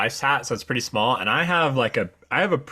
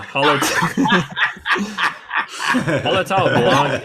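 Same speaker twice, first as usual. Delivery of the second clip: laughing through a tricky name; slow, laughing at the hard word; 6 semitones higher, 4 dB louder, much slower than usual. Real, not acted. Laughs stumbling over hop name.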